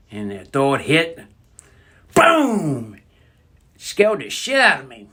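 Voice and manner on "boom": explosion noise. Retold or imitated. Cigarette explodes in story.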